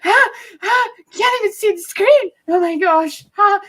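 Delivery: hammed-up frantic panic; panicked. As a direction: act out racing to the screen in a panic. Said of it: "I was like".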